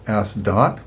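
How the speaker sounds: plain reading voice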